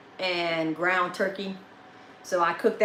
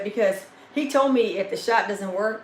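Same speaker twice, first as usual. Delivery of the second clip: ordinary delivery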